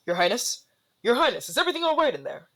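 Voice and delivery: fast, high anxious calling; concerned guard. Acted. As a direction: call through the door with worry. Guard calling through door.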